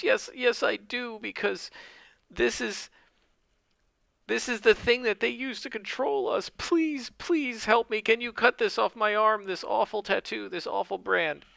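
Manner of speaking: high, tearful pleading woman's voice; sobbing cult woman. Acted. Fake cultist woman begging Wiglaf to cut off her brand, high tearful plea. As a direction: beg through fake tears, pitched high. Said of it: "she's sobbing and crying".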